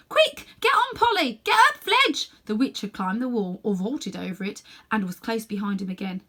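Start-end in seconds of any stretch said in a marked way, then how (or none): 0.1-2.3 high, loud urgent shout to his friends. Acted. Boy shouting to his friends to mount, high and loud. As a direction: yell for them to get going. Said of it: "was shouting out".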